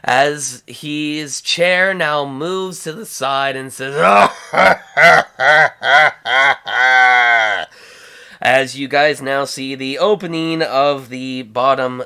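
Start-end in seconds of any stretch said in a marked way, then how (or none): 4.0-7.6 loud, booming staccato cackle; villain belly laugh. Acted. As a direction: laugh hugely in triumph. Jabba laughs as captive falls.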